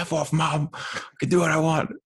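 high-pitched acted teen backtalk to mum; mock bratty kid. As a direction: whine back like an entitled son. Voicing bratty kid cursing his mother.

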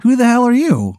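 high, startled who the hell; alarmed demand. Acted. Bartender confronting the sweaty stranger, alarmed. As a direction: push back, rattled and confused.

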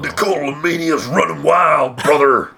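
high, loud Hulk Hogan-style shout; wrestler promo voice. Pretend. Wrestling announcer catchphrase parody.

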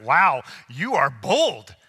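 slow, drawn-out awe; slow retold astonishment; amazed reaction. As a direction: stretch each word in impressed disbelief. His amazed reaction to the evangelist.

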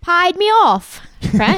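high-pitched attempt at Geordie; Geordie accent. Showing friends how Geordie Shore people sound, in Geordie. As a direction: try out a Geordie reality-show accent. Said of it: "you know what they sound like".

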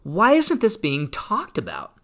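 high, incredulous whoa, slowed-down disbelief; retold astonishment. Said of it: "I came upon this and I went".

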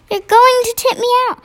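put-on high, fast, anxious girl; a frightened little girl hurries. A narrator's reading. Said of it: "Sophie whispered".

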